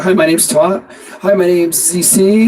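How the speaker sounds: each "Hi, my name's" ending on a rise; rising question tone. Shown as a demonstration. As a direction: uptalk the introduction like a question. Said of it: "what you hear is the question in the voice".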